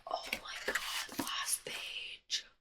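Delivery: hushed breathless whisper. Real, not acted. Reaching the last page, whispering. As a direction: whisper in suspense. Whispered anticipation at book end.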